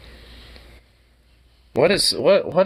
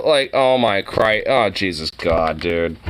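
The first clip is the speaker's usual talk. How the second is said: ordinary delivery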